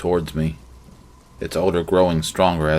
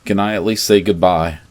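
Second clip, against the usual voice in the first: ordinary delivery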